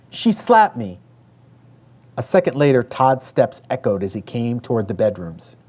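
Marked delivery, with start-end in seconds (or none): 0.1-1.0 high, sudden startled cry. A narrator's reading. Younger daughter crying out to dad, startled outburst. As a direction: cry it out, shocked and stung. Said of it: "cried out, startled".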